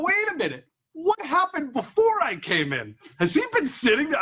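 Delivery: high-pitched incredulous questioning; mock disbelief. Acted dawning disbelief in retold thought.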